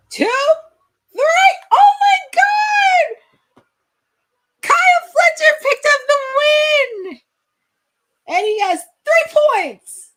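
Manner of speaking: full-throated victory scream, slowed on Oh my God; thrilled scream. Real, not acted. Watching the three count, screaming as the favorite wins.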